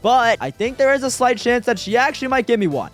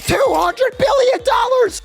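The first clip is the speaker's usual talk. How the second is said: very high, drawn-out excited yell; astonished shout; much slower than usual. Real, not acted. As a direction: shriek the amount slowly in amazement. Sees the huge value.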